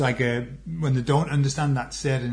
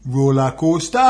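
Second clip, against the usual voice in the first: louder mock Geordie on "Roller coaster"; Geordie accent; 5 dB louder than usual. Retelling a comic's lesson on learning Geordie, Geordie accent. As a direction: put on a comic's Geordie lilt. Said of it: "he was saying, to learn Geordie".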